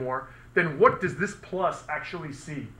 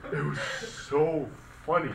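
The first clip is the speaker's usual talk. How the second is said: slow words through real laughter; can barely get the words out for laughing; slower than usual. Real, not acted. Laughing at the stun-gun story.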